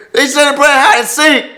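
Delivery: high, loud yokel voice; hammed-up country yell. Husband answers wife.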